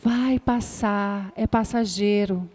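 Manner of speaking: soothing. Shown as a demonstration. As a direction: soothe the upset child, slow and soft. Acalmando a criança. (voz tranquilizadora, lenta e mais suave)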